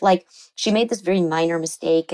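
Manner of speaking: ordinary talk, nothing marked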